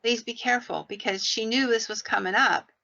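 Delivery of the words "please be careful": ordinary delivery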